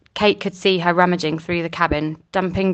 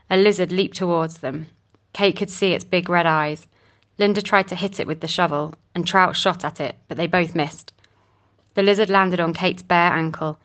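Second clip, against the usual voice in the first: ordinary delivery, nothing marked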